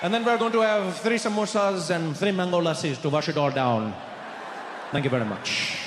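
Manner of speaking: put-on overdone Indian accent, proudly ordering; too-hyped Indian accent impression. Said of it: "that's when I Trudeau'd".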